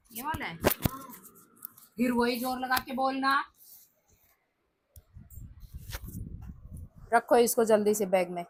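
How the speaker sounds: loud, high, hurried scolding; scolding mother. Real, not acted. Mother loudly rushing son to tuition.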